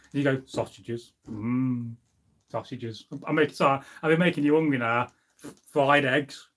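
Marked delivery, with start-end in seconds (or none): 0.5-3.1 soft, low, dreamy murmur of "sausages"; savouring sausages. Shown as a demonstration. Tempting viewers with food, softly savouring. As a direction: breathe the word like it's on the plate. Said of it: "You've got to say it slowly".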